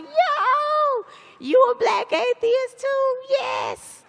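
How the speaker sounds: high, loud, thrilled squeal of recognition; excited greeting. Retold or imitated. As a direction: gush like you just found your long-lost twin.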